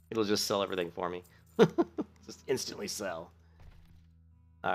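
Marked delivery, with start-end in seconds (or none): 1.6-2.0 short laugh. Real, not acted. Short laugh while selling items.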